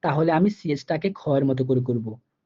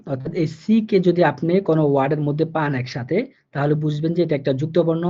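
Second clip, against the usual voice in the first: ordinary delivery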